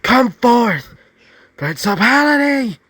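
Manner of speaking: high, loud summoning cry; screamed summons. A narrator's reading. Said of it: "Izuku would scream out forth".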